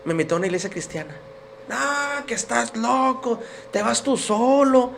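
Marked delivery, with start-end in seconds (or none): 1.7-4.9 higher, drawn-out dismissive refusal; slow, raised-pitch mocking retort, imitated; imitated scornful wife. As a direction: mimic a wife brushing the idea off. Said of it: "mi esposa".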